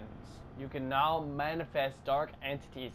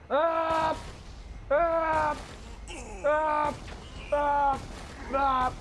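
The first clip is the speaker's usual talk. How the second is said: repeated shouts; 11 semitones higher, much slower than usual. Real, not acted. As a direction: shout each one as you strike. Repeatedly attacking.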